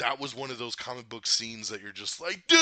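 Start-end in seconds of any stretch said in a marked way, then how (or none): 2.5-2.6 excited exclaim. Retold or imitated. Reacting to epic comic scene.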